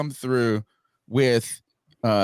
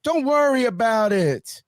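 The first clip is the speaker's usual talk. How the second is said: a lightly higher, dismissive don't worry; mock reassurer; 6 semitones higher than usual. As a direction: brush it off mockingly. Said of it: "you got people going".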